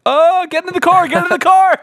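very high, loud panicked cry; panicked yell. Retold or imitated. Host retelling a scary night in Belfast, high panicked yell. Retold scare walking at night.

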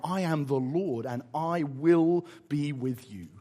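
slow, low, solemn divine assurance; God reassuring. Retold or imitated. God telling Moses not to worry, slow and solemn. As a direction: comfort them with measured weight. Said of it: "God says".

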